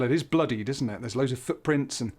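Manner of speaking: ordinary delivery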